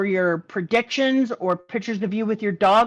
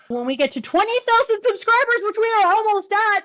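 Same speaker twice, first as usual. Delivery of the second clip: excited; 9 semitones higher than usual. Real, not acted. Excited announcement to viewers.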